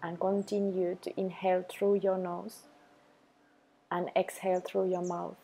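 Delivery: calm, even meditation voice with long pauses; unhurried, level delivery for a self-love practice; guided meditation. Acted. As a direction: guide the listener gently into the breath, leaving space after each phrase.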